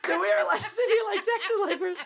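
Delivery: high, loud, laughing storytelling. Real, not acted. Telling friends about texting the intimidating man, laughing hard. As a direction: squeal the words out through real laughter.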